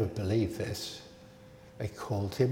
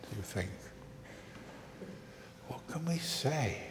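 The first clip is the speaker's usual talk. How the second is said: whisper; 13 dB quieter than usual. Real, not acted. Whispered question to children.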